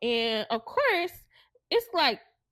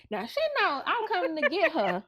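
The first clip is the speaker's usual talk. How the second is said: higher-pitched mock fussing; 6 semitones higher, 4 dB louder than usual. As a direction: fuss as you retell it, voice lifted. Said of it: "So I'm fussing".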